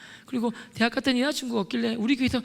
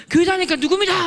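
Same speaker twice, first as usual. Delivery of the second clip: loud, high demanding question; shouted interrogation; 6 semitones higher, 7 dB louder than usual. Retold or imitated. Grilling a classmate on faith, acted angry shout. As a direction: demand the answer at full voice.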